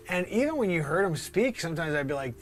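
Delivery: ordinary delivery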